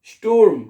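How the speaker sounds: स्टूर्म loud and clear as "shtoorm"; correct German pronunciation. Shown as a demonstration. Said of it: "ऊ पहले पढ़ना है". (स्टूर्म ज़ोर से, पहले ऊ फिर आर)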